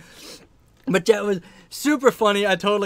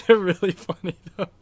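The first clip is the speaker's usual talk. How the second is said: real giggle breaking into the words; amused, laughing aside. Real, not acted. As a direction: talk through a laugh. Cracks up recalling own old videos.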